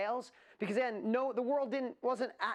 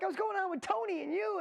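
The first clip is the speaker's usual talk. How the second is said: very high imitation of an overexcited guest; excited gushing; 12 semitones higher than usual. Host imitating guest thrilled to share the stage, excited. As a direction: mimic his excitement high in your voice. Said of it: "He was so excited yesterday".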